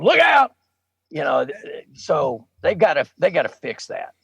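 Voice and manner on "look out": sudden high, loud shout; yelled warning. Retold or imitated. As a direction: yell it like a teammate warning the quarterback. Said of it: "guys are yelling at him".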